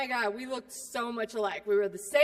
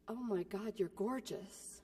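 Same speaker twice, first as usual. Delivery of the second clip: hushed, softer, slow awe; awed hush; 3 semitones lower, 10 dB quieter than usual. Retold or imitated. Sisters meeting, hushed slow mock awe. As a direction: breathe it out in mock wonder.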